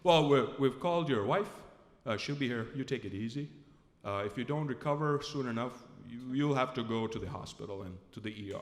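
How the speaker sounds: low, steady doctor voice; imitated medic advising calmly in a deep voice; deep, unhurried instructions; medical staff. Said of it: "So then they say".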